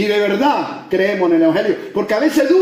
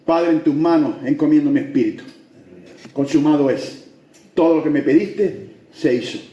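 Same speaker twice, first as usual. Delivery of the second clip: solemn voice of Christ; 8 semitones lower than usual. Retold or imitated. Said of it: "cuando estaba en la cruz".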